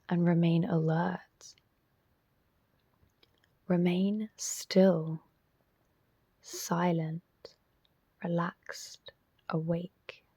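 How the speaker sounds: measured, spacious delivery, leaving silence after each instruction; guided meditation. Real, not acted. Teacher guiding listeners into self-inquiry, guided meditation voice.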